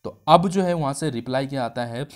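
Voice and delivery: ordinary delivery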